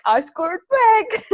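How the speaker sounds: high, slow, gleeful brag; dad crowing over toilet paper; dad's excited boast. Retold or imitated. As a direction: crow it like winning the lottery. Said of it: "My dad's like".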